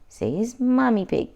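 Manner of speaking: usual speaking voice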